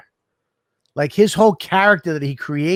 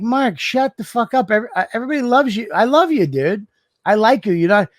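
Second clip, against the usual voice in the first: pitched-up mock telling off to a friend; much higher, fast, half-joking scolding; exasperated reassurance; 6 semitones higher than usual. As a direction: scold him into feeling liked. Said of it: "and I'm like".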